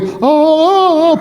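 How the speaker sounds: mock Tarzan call. Mocking someone parading animals, Tarzan call. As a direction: imitate Tarzan calling the animals. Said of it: "nobody's out there going".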